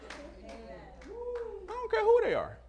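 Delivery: high, slow laughing defiance; a laughing, high-pitched "I don't care who they are". Real, not acted.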